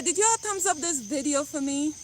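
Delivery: ordinary delivery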